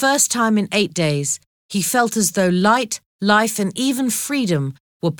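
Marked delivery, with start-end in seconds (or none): none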